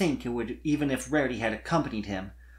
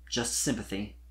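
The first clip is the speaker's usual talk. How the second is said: usual speaking voice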